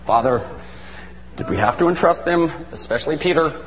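low, subdued aside to a father; dismayed prayer. Acted. Jesus questions the Father about entrusting Peter, low dismay. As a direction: groan it low, as if regretting the choice. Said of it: "He's like".

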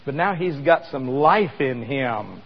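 ordinary talk, nothing marked

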